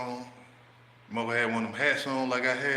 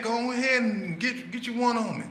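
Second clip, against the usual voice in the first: egging on; 12 semitones higher than usual. Retold or imitated. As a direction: push him to throw it. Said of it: "talking to him nice and calm".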